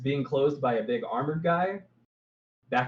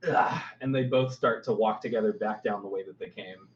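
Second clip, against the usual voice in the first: usual speaking voice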